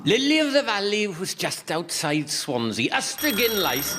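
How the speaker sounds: lilting mock Welsh voice; Welsh accent. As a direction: put on a bad Welsh accent. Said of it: "Then why is he doing a South African accent?".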